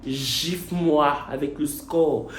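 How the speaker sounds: traîner chaque mot, suppliant et théâtral; lent et suppliant. Pretend. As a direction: implorer lentement, pour rire. (lent et implorant)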